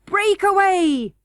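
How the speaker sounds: high, slow defiant shout. A narrator's reading. Curdie shouting back at the prince, slow and defiant. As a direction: dare them to try. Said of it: "shouted Curdie".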